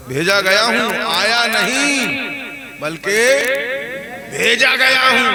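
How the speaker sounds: rising forceful preaching answer; emphatic reply. Retold or imitated. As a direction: stress that he was sent, forcefully. Disciple answers the jinn chief.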